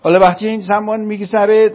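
ordinary talk, nothing marked

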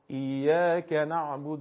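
"ایاک نعبد" with the beat on "ka", joined onward; stress on kaf. Shown as a demonstration. Teacher showing misplaced stress in "ایاک نعبد", beat on kaf. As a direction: strike the ک of "ایاک" so it links to "نعبد". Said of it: "ضربه روی حرف کاف بزنم".

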